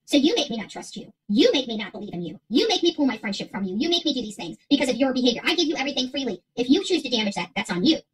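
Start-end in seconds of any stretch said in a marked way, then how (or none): none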